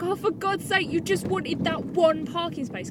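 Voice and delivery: high, loud fed-up complaint; exasperated outburst. Retold or imitated. Stuck behind a parking car, exasperated rant. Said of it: "I was just like".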